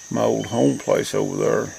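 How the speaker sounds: usual speaking voice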